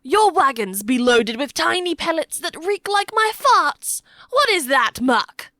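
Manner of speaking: loud, high-pitched, indignant demand; a coarse soldier butting in with disgust; gruff henchman questioning. A narrator's reading. Balding Norman questions the captive.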